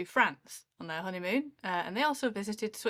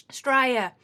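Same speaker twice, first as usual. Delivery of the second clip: "Australia" said louder, Aussie style with falling end; Australian accent; 5 semitones higher, 9 dB louder than usual. Shown as a demonstration.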